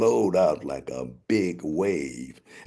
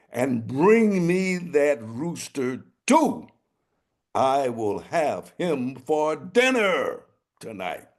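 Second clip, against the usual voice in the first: high, loud royal rage; furious king; 9 semitones higher, 5 dB louder than usual. Acted. King furious at his messengers, roaring high and loud. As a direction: roar the orders like a furious king.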